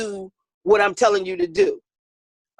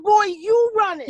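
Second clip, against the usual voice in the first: scolding; 8 semitones higher than usual. Retold or imitated. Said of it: "I said".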